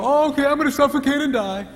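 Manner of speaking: high, whiny mock wife. Preacher mocking a wife who gives in meekly, high and whiny. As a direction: play the wife as a melodramatic victim. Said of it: "she's not gonna go".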